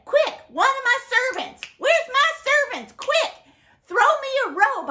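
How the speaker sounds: high, loud urgent calls to the servants; excited calling out. Acted.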